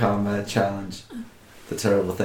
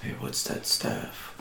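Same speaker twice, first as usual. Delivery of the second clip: low, slow whisper; 10 semitones lower, 12 dB quieter, slower than usual. Real, not acted. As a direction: whisper so nobody hears. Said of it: "Shh, we're gonna get in trouble".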